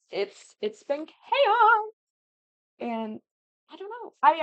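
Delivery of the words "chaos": high, loud, long-stretched chaos; exaggerated drawn-out outburst; playful exclamation. Real, not acted. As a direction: sing out the word, dragging it high. Describes her scattered life.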